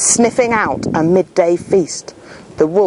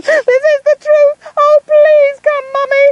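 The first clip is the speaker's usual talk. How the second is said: desperate call; 4 dB louder than usual. Acted. Boy pinned by wolf calls desperately to town, high and loud. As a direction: scream for Mummy in panic. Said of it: "Nick called desperately".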